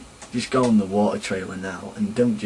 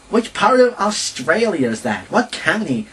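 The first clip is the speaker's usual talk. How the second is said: mock Californian, much higher and louder; clueless American put-on voice, pitched up high; imitating California accent; 9 semitones higher, 4 dB louder than usual. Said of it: "really thick California accents".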